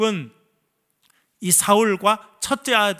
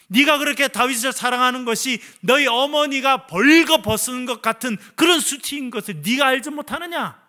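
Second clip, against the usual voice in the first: Saul's raised, higher rebuke to his son; furious king; 6 semitones higher, 3 dB louder than usual. Acted. King Saul berating Jonathan, raised and furious. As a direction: lash out at your son. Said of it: "화를 엄청 냅니다".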